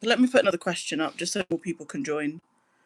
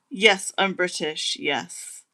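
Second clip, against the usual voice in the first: ordinary delivery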